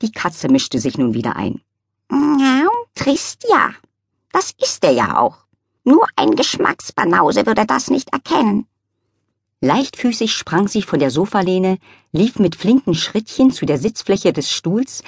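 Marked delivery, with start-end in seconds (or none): 2.1-8.7 high, snooty cat drawling her scorn; a prim cat looking down on everyone; sniffy high cat sneering at the chair; haughty cat voice. Acted. Cat character voice.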